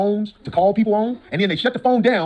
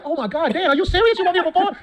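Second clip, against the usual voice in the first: high-pitched, amused retold shock; raised, questioning astonishment; incredulous disbelief; 9 semitones higher than usual. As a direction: act out nervous disbelief for laughs.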